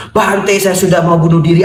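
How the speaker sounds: high-pitched mock-desperate cry; desperate outburst. Ruined man alarming the monk, mock-desperate outburst. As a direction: burst out in hammed-up despair.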